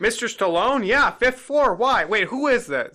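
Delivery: high, loud chirpy clerk; breezy clerk blurting it out; hammed-up careless receptionist; mock hotel clerk.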